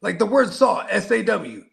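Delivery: ordinary delivery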